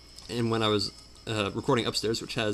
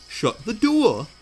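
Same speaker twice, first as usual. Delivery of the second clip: drawn-out Yorkshire "door", high and loud; Over the Gun; 10 semitones higher, 6 dB louder, much slower than usual. Retold or imitated. Repeating it stubbornly to his partner, hammed-up Yorkshire accent. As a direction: exaggerate a bad Yorkshire accent, slowly. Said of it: "that's my impersonation of him".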